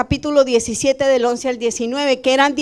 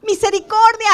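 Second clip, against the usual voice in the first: usual speaking voice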